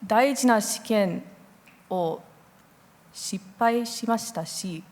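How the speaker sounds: ordinary talk, nothing marked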